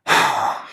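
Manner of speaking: acted hard puff of air; imitated heavy breath. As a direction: let out a big voiceless breath. Retold breath as sight closes in.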